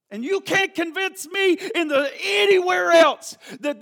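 high, loud preacher's shout; defiant insistence. Acted. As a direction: cry out as someone who will not be persuaded. Acts out a stubbornly convinced person.

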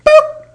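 tiny squeaky boop sound; cartoon boop. The monkey shoves the GoPro off, squeaky cartoon boop. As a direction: pop it out very high and loud. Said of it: "It goes".

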